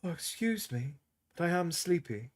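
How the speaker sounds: barely awake, about to drift off; sleepy murmur. A narrator's reading. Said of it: "She smiled and yawned".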